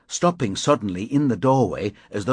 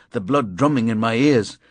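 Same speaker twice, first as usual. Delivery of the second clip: usual speaking voice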